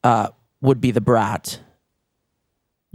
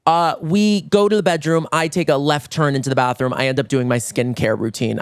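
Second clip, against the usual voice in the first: ordinary delivery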